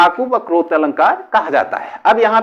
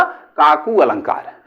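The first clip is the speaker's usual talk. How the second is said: ordinary talk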